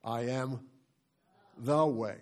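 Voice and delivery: THE punched after a long pause; stress on THE. Shown as a demonstration. Pastor leading the congregation word by word, stress on THE. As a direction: stop after "am", then hit "The". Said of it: "we're gonna stress each one".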